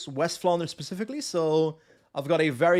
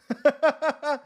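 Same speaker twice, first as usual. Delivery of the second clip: laugh; 10 semitones higher, 4 dB louder than usual. Real, not acted. Laughs after own dialect demo.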